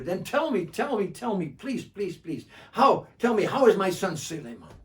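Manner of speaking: high, begging for news; eager pleading. Acted. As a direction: plead eagerly, repeat tell me. Bedou begs for news.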